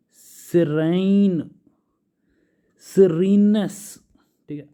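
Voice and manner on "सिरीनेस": सिरीनेस higher, louder and slow; emphasized word. Shown as a demonstration. Teacher lingering on सिरीनेस for students. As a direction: lift and stretch सिरीनेस. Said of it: "teacher stressing word".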